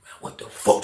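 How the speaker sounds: hushed, breathy disbelief; whispered shock. Retold or imitated. Inmates whisper to each other, shocked. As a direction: whisper it like a scared onlooker.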